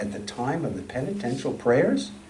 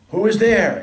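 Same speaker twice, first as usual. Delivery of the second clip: higher, louder startled call through the window; a sick old woman startled by a knock at dawn; frightened voice; 8 semitones higher, 6 dB louder than usual. A narrator's reading. Sick widow calls out frightened at the knock. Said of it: "asks a frightened voice".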